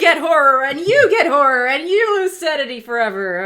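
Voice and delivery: loud, high talk show giveaway shout; booming Oprah-style announcement. Acted. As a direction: hand out horror like prizes to a cheering audience. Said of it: "that was my Oprah Winfrey".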